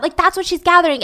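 usual speaking voice

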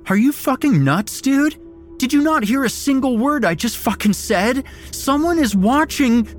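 high, loud furious panic; panicked shout. Acted. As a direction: scream at him in terror. Friend screams refusal in panic.

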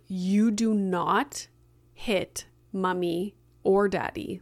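slow, measured, retold reprimand; firm scolding. Mom telling son off for hitting, slow and firm. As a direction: lay down the rule slowly, word by word. Mother firmly scolds child who hit.

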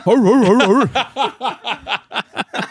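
high, repeated comic dog howl-barks; mock dog barks running on and on; dog barking. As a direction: bark like a dog gone wild. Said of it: "he starts barking".

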